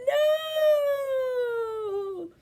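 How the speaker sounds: high playful cry of alarm; hammed-up shock for the kids; mock alarm. Teacher pretending fire on her head, playful cry.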